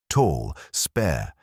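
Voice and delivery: usual speaking voice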